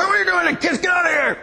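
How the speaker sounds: cranky homeowner yelling at children playing out front, high and harsh; grumpy neighbour. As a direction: chase the kids off the lawn. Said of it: "someone's gonna say".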